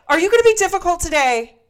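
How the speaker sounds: high, loud mock-sweet challenge to the cashier; sarcastic challenge. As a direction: ask it like a sarcastic dare. Confronting cashier, retold quote.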